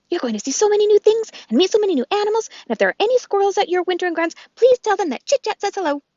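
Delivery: high, bright squirrel voice, quick and eager; a chirpy little squirrel popping down from the trees; the squirrel Chit Chat greeting Bright Bill, high and chirpy; chattering squirrel. Acted.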